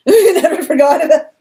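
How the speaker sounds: scoffing laugh at the line. Real, not acted. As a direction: burst out laughing mid-retort. Laughing at the quoted admirer.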